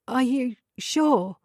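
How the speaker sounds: high, slow and cracking. A narrator's reading. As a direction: let the voice break on it. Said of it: "Her voice cracked".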